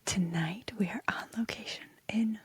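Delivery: hushed late-night whisper. Real, not acted. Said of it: "Why are you whispering?".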